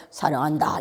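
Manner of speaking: lowish, matter-of-fact declaration; understated, subdued declaration of love; low, unloving tone. Shown as a demonstration. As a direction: say it low with little lift. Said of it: "이제 그게 틀리다는 거지".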